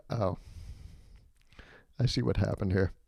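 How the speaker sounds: soft, knowing, said half to himself; quiet dawning realization. Retold or imitated. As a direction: let the penny drop quietly. Narrator realizing why he's chosen.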